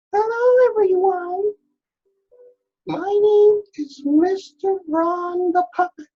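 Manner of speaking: very high, loud cartoon puppet squeak. Shown as a demonstration. Art teacher demonstrates puppet for pupils, high puppet voice. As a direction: pitch it way up for a hand puppet saying hello. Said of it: "give it a high voice like I did".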